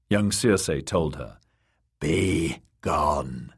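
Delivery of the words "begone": low croaking crone. Acted. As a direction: croak it out like a witch. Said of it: "croaked the old woman a second time".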